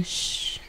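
soft, unvoiced imitated hush; shushing. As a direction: shush the woman. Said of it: "Saying to a woman". (chistido suave imitado)

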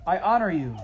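a mouse shouting a knight's vow. Acted. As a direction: call the vow to the princess. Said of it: "shouted Despereaux".

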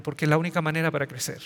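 ordinary delivery

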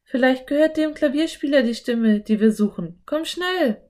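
put-on girl voice, keen to go in; eager Anne. A narrator's reading. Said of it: "sagte Anne".

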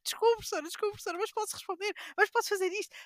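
high, pleading pupil voice; mock eager pupil asking teacher; imitating eager pupil. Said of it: "Ele disse sempre".

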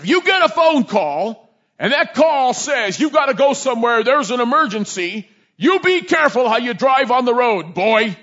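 a stern mentor drilling a rookie; stern officer warning. Retold or imitated. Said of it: "That's the way he talked to me".

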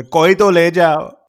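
loud, high, slow hawker's cry; hammed-up plea in Urdu to take him away; mock vendor call. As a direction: call out like a street seller. Calling out to sell him off.

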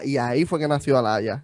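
ordinary delivery

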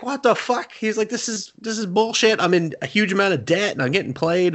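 high-pitched outraged protest; hammed-up incredulous griping; mock indignation. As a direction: complain like someone who's been cheated. Character protests being cheated, retold.

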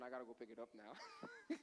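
low, soft words breaking into laughter; laughing. Real, not acted. Preacher admits a mishap to congregation, laughing. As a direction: chuckle quietly through the words.

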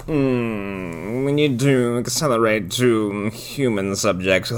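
slow, drawn-out put-on director voice; purring director. Director orders a move to human subjects, slow purr. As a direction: purr it slowly with a hum first. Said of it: "sentences and kind of purrs".

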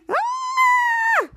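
high-pitched, sustained cartoon scream; acted scream. Retelling both characters screaming in the elevator, mock scream. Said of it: "both of them were screaming".

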